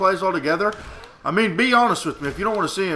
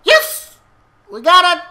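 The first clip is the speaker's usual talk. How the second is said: excited; 13 semitones higher, 9 dB louder than usual. Real, not acted. Repeats win cheer, higher.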